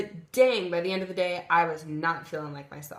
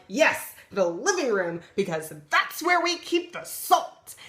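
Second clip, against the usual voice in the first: much higher, slow, louder sarcasm; sarcastic rage; 4 semitones higher, 4 dB louder than usual. Retold or imitated. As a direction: spit it out sarcastically, slow and loud. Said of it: "I lost my shit again".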